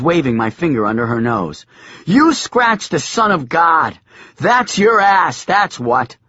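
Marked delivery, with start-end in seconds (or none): 2.0-6.2 high loud slow scolding; furious yelling. A narrator's reading. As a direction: wag a finger, furious. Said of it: "I was furious".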